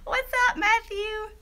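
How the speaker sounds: squealed high greeting to a viewer; light up as a new name appears; thrilled to see a friend join the stream; excited greeting. Real, not acted.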